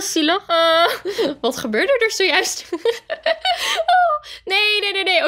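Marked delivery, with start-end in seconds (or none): 2.7-5.3 high laugh. Real, not acted. Player laughs at her character's sudden death.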